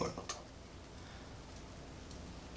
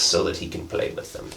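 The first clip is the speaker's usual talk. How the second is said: whispered aside; 5 semitones lower, 5 dB quieter than usual. Real, not acted. Aside to the child.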